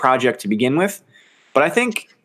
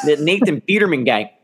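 normal talking voice

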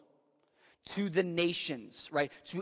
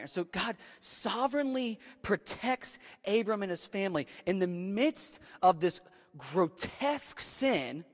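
ordinary talk, nothing marked

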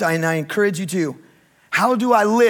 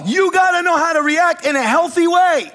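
high, driving preacher's exhortation; impassioned preaching; 8 semitones higher than usual. Real, not acted. Pastor urging congregation to be emotionally ready, impassioned preaching. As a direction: preach it urgently, pitched well up.

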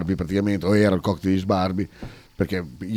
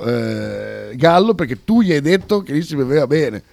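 usual speaking voice

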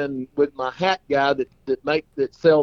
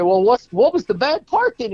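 much higher, eager question; curious friend; 9 semitones higher than usual. Acted. Friend pressing the clown for the bad part, eager. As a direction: lean in for the punchline.

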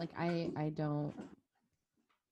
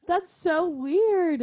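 laughing; 10 semitones higher, 6 dB louder, much slower than usual. Real, not acted. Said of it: "reacting to accent, laughing".

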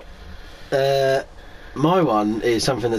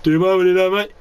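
cheeky passer-by; 6 semitones higher, 4 dB louder, faster than usual. Retold or imitated. Imitating passer-by joke.

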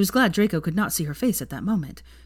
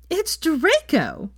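much higher, slow, gleeful; gleeful cry; 11 semitones higher, 4 dB louder, much slower than usual. Acted. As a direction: squeal it out in glee. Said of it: "cried Veronica, beside herself with glee".

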